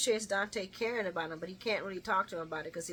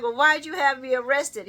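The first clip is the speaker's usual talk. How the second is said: loud, raised mock-indignant question; playfully outraged retelling; 5 semitones higher, 11 dB louder than usual. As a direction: re-enact an affronted demand.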